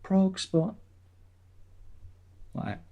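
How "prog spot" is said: high radio jingle sting; sing-song station ident; jingle voice again, high. Pretend. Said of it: "imagine it's got a jingle".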